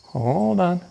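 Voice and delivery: high soft hold on to a dog; calm the dog down; soothing dog. Real, not acted. Settling the dog.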